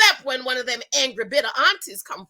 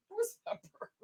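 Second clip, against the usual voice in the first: a hint of a high, much softer whine; lightly mock a fussy man looking for his purse; mock whiny man; 9 semitones higher, 14 dB quieter, faster than usual. Mocking Shaq grabbing purse.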